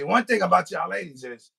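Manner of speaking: ordinary talk, nothing marked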